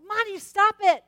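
loud, high scolding shout; shouting at the scratching dog; yelling at dog. Retold or imitated. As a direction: order the dog down. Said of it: "and I'm like".

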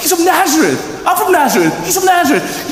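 yelling Nazareth with pride; proud hometown shout. Retold or imitated.

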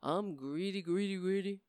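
low, soft put-on voice for the greedy line; a hushed pretend Obama; imagined Obama saying it. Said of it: "Imagine Barack Obama getting up... saying".

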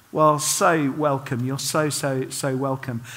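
soft, slow, sincere welcome; warm welcome. Real, not acted. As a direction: welcome them gently and sincerely. Welcoming non-believers.

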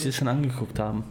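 ordinary talk, nothing marked

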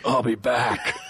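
low, understated menace; lightly voiced tough guy; threatening townie.

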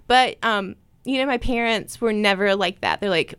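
normal talking voice

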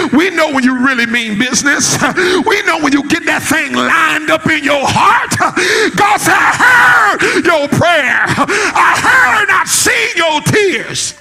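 high, drawn-out climactic proclamation; full-force preaching. Real, not acted. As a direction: rise into a ringing pulpit cry.

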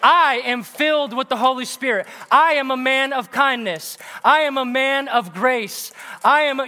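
bold declaration. Pretend. Preacher showing the church how to declare, boldly. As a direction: proclaim it like you truly believe it. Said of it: "You're gonna say".

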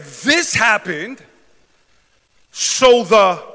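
slow, loud drawn-out preaching. Acted. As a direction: stretch it out for the crowd. Preaching builds.

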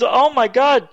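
high-pitched imitation of dad's awe; amazed exclamation. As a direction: exclaim it in acted astonishment. Dad's amazed cry in retold call.

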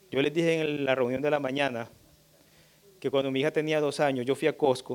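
ordinary delivery

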